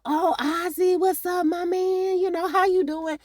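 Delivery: high, chummy fan voice; fawning cop greeting. Retold or imitated. Detectives fawning over the child star, high and chummy. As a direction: gush at him like a starstruck fan.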